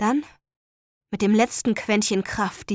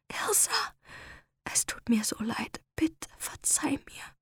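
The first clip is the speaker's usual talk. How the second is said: whisper; 4 semitones higher, 9 dB quieter than usual. Acted. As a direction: whisper a dying plea. Dying plea while being strangled.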